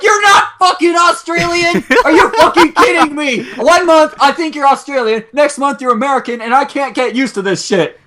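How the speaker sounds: hammed-up fury at the prank; mock outrage. Retelling outrage at month-long prank.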